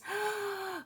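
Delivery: sharp, high breathless gasp of delight; soft thrilled intake of breath. Real, not acted. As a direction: gasp at something gorgeous. Sees palette colors.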